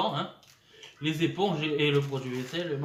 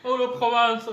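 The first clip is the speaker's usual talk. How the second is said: excited; 9 semitones higher, 11 dB louder, slower than usual. Real, not acted. Delighted exclamation about Provence.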